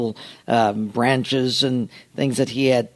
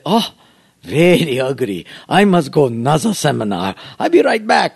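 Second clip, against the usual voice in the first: hammed-up Japanese accent; mock bonsai master. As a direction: pass judgement like a mock master, then decide briskly. Mocks pastors in the master's voice.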